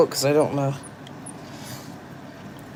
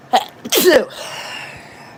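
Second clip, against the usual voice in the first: sneezing; 12 semitones higher than usual. Real, not acted. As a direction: let out a sudden sneeze. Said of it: "I'm gonna sneeze".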